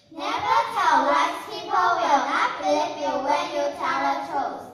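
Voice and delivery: loud group recital; chorus recitation. Acted. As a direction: say the moral together to the audience.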